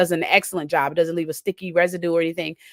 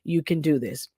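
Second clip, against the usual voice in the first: soft, quieter reassurance to herself; calm inner voice; 5 semitones lower, 6 dB quieter than usual. Retold or imitated. Woman calming herself over the spilled paint, soft and quieter. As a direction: gently talk yourself down. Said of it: "there was a calm in me".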